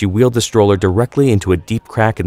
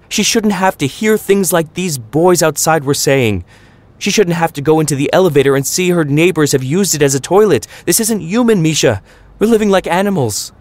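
climbing higher and higher, fed-up tirade; raging rant; 8 semitones higher than usual. A narrator's reading. Mama venting to Papa at dinner prep, high climbing angry rant. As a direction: rant like every word is a knife whack. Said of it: "Mama raged at Papa".

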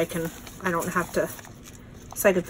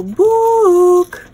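high loud squeal of delight; much higher and louder delighted cry; excited squeal; 10 semitones higher, 10 dB louder than usual. Real, not acted. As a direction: shriek happily at the tiny book. Delighted at tiny book.

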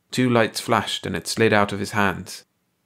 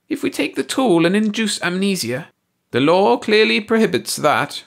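high alien voice; 12 semitones higher, 4 dB louder than usual. A narrator's reading. Ursi argues.

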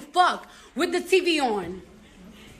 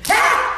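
loud, abrupt "ha"; single loud ha; 5 dB louder than usual. Real, not acted. Capping the joke about levels, loud single ha. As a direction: punch out one loud laugh. Loud laugh before the punchline.

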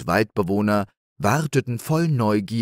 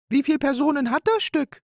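much higher, fast, chattering magpie; fluttering with eagerness; excited magpie; faster than usual. Acted. Magpie hopping about asking how many roles, excited. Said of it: "trippelte aufgeregt hin und her".

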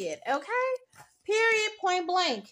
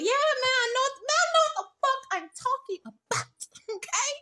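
excited boast; 8 semitones higher than usual. Real, not acted. Boasting about her reading.

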